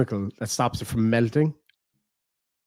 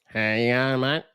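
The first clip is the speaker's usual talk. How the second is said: put-on easygoing Australian accent; slurred-together casual Aussie greeting; laid-back Aussie lingo. Shown as a demonstration. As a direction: toss out a relaxed Australian hello. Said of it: "It's so laid back".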